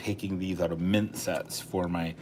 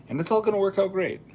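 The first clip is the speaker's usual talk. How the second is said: excited; 12 semitones higher, 5 dB louder, faster than usual. Real, not acted. Cheerful wrap-up of album plan.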